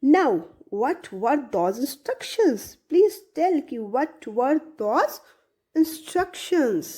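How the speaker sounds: ordinary talk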